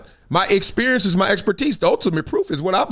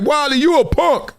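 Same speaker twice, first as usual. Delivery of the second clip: mock taunt; 9 semitones higher, 3 dB louder than usual. Taunt voiced from a stranger.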